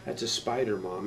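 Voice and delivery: low, soft, hesitant answer; retold sheepish admission; sheepish son. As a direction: mumble it low to your mom.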